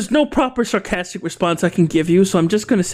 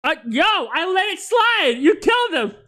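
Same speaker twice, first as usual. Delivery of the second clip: shocked outcry; 9 semitones higher than usual. Real, not acted. Shocked at game kill.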